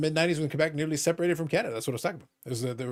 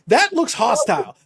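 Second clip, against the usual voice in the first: slow, high, louder alarm; mock alarm; 7 semitones higher, 6 dB louder, slower than usual. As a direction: act scandalized.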